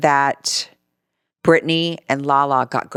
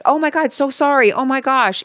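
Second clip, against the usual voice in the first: flustered apology; 8 semitones higher than usual. Retold or imitated. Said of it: "He was just like".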